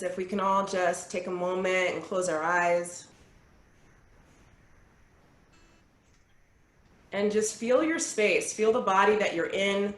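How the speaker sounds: calm, unhurried guiding voice with long pauses; guided meditation. Acted. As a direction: lead the group gently through their breathing. Leads a breathing meditation.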